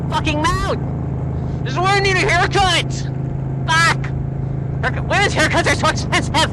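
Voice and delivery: real annoyed shout over his helmet hair; frustrated outburst. Real, not acted. Hair blowing into his mouth.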